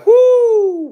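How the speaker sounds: high whoop breaking into a laugh. Real, not acted. Laughing on her live about the good pills, loud whoop.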